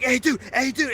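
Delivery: high-pitched imitation of an eager guy; overexcited greeting. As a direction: pester him like an overexcited fan. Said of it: "way too overly excited to see me".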